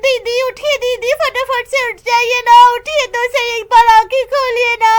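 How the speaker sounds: frantic friend at the accident scene; shocked neighbour woman. Acted. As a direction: rush in panicked, begging her friend to wake. Woman finds her friend badly hurt.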